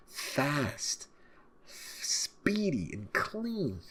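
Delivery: high, very slow stretched praise; drawn out. Real, not acted. Viewer praising the ninja's stealth, high and very slow. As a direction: savor each word.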